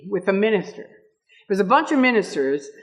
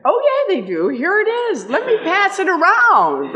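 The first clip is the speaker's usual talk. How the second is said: high, bright-voiced mock helpfulness; cheerful gotcha; 11 semitones higher, 4 dB louder than usual. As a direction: hand it over sweetly, knowing you've won. Cheerfully exposing the declaration.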